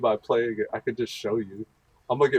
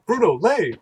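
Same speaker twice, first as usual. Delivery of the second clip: higher, louder insistent order; mock exasperated command; 9 semitones higher, 5 dB louder than usual. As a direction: repeat the command, now fed up. Escalating dog command war.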